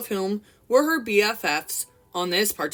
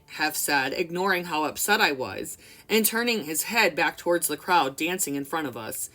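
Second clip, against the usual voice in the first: ordinary delivery, nothing marked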